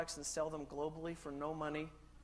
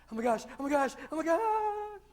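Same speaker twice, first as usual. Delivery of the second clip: high, loud panicky squeals rising higher; mock panic; 11 semitones higher, 9 dB louder than usual. First car drivers panicking at the dashboard, loud rising squeal. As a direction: freak out like a first-time driver. I bet they were freaking out.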